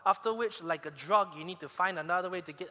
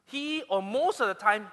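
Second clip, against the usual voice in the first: usual speaking voice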